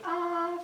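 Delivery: high loud open ah; sustained ah. Shown as a demonstration. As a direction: hold an open ah sound. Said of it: "have you say".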